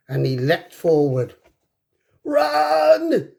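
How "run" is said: much higher, louder urgent yell; shout. A narrator's reading. Hiccup shouts to his friends to flee the dragon, urgent yell. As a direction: warn everyone to flee. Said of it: "shouted Hiccup".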